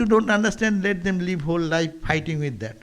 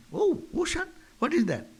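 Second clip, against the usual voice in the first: surprised well frog; 8 semitones higher, 4 dB quieter than usual. Acted. Well frog hears of ocean.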